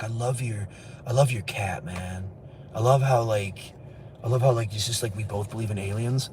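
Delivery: a mock partier gushing to a friend; imitating a coked-up guy. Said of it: "Cocaine makes you all like".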